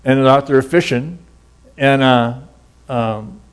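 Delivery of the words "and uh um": ordinary delivery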